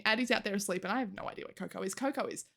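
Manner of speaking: ordinary talk, nothing marked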